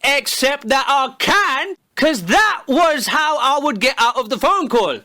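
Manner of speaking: normal talking voice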